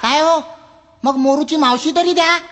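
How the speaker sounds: high, whiny request; pleading customer. Acted. Customer begs the librarian, high and whiny. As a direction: wheedle for any book at all. Customer asks for another book.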